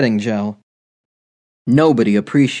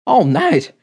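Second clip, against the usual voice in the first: higher, flustered sputter; 5 semitones higher than usual. A narrator's reading. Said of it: "he sputtered".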